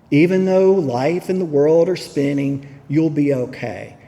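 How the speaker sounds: normal talking voice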